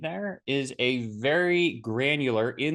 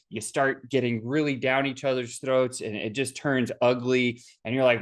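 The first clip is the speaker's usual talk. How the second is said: ordinary delivery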